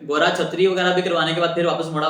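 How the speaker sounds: ordinary talk, nothing marked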